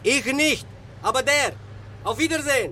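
high, loud and clipped; curt, loud farewell. Acted. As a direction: get rid of him fast. Driver snaps and leaves.

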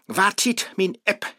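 ordinary talk, nothing marked

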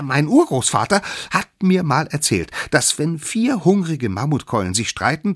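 high squeaky character voice; animated little one explaining importantly; smallest drumstick, eager. Acted. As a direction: pitch a bright idea in a high little voice. Smallest drumstick makes a proposal.

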